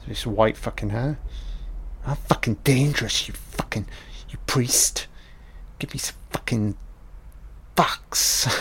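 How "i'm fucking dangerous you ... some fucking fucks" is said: hushed, half-whispered gangster threat; softer, breathy menace like a thug; menacing villain. Retold or imitated. Voicing Snow the villain.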